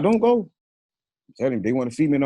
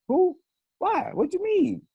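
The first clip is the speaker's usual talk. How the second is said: very high, startled questions; squeaky high disbelief; incredulous; slower than usual. Retold or imitated. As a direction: act baffled and caught off guard. Quoted confused reply.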